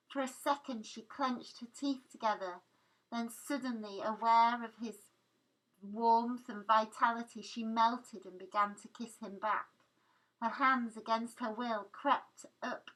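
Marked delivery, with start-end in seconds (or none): none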